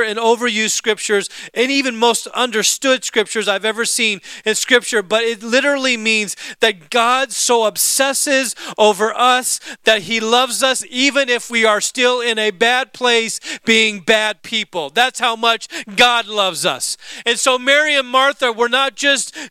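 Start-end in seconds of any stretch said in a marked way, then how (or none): none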